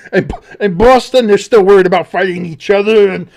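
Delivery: loud, high, laughing delivery; talking through laughter. Real, not acted. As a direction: push the words out while cracking up. Talking while cracking up.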